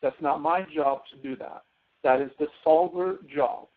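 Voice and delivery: normal talking voice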